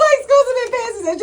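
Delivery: high, loud laughing protest; words pushed through a big laugh. Real, not acted. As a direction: burst out laughing as you argue back. Laughs while arguing back at co-host.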